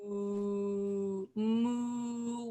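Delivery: cow moo. Pretend. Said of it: "what does the cow say".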